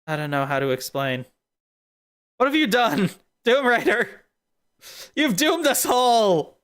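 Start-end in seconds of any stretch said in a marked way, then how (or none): 2.4-6.6 hammed-up cry of doom, pitched high; mildly overdone dramatic lament; mock doom. Streamer laments to Doom Rider that all are doomed, hammed-up.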